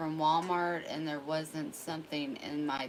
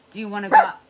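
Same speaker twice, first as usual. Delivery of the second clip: louder, bright question to the dog; excited dog-talk; 4 semitones higher, 16 dB louder, much faster than usual. Real, not acted. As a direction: pump the dog up to go out.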